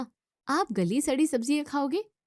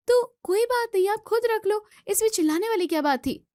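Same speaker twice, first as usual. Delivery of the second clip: exasperated scolding; 9 semitones higher than usual. Acted. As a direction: snap back at him, fed up. Sister scolds shouting brother.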